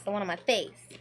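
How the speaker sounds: ordinary talk, nothing marked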